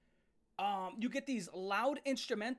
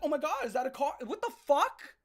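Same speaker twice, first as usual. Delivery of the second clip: loud, higher hammed-up alarm; mock shock; 4 semitones higher, 6 dB louder than usual. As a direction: overact being startled, building to a yelp. Acted shock at the car.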